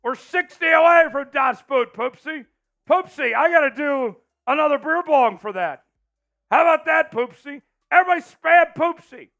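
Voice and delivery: loud high party hype; frat bro hype. Real, not acted. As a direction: pump up the crowd like a frat party. Hyping donor, beer bong.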